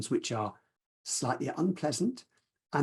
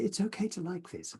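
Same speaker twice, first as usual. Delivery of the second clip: softer, higher, gentle permission; soft inner phrase; 5 semitones higher, 5 dB quieter than usual. Shown as a demonstration. Teacher modelling the inner phrase for listeners, softer and higher. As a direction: offer it to yourself softly. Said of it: "and then say".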